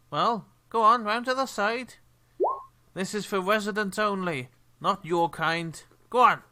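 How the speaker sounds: loud, high, sneering dockhand; dismissive port worker. Acted. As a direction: shoo the strangers off, loud and high.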